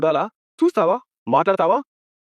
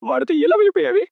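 furious yell; 10 semitones higher than usual. Acted. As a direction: spit the question out in rage. Brother confronts sister's husband. (వాడితో ఎలా వెళ్ళిపోయావి అని ఆగ్రహంతో అరుపు)

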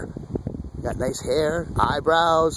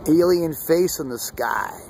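ordinary delivery

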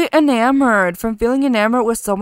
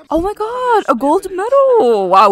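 delighted surprise; 11 semitones higher, 5 dB louder than usual. Real, not acted. Gamer squealing at a surprise gold medal. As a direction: gush over the surprise medal.

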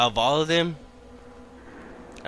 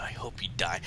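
whispering; 6 semitones lower, 9 dB quieter than usual. Real, not acted. Whispered curse at monster.

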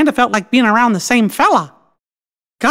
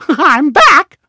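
joyful announcement; 4 semitones higher, 4 dB louder than usual. Acted. Character joyfully announces return.

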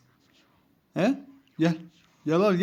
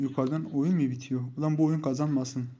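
usual speaking voice

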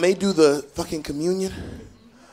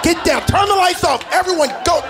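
high frantic mom shout; panicked mom; 10 semitones higher than usual. Retold or imitated. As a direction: yell it like a scared mother hiding everyone. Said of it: "She's like".

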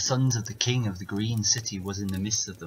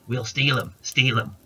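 crow voice; 3 semitones higher, 3 dB louder than usual. Acted. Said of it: "says a hoodie".

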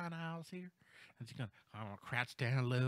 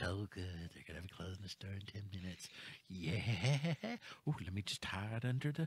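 lower, softer, mock-oblivious shopper muttering; mock clueless shopper; 8 semitones lower, 4 dB quieter than usual. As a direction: lightly play the oblivious customer. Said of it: "I'm going to do a dramatic reenactment".

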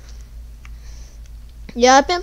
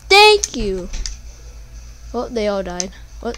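normal talking voice

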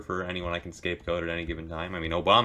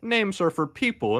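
ordinary delivery

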